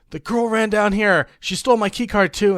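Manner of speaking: hammed-up relief; mock girl's voice. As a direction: imitate a girl feigning relief. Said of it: "And she's like".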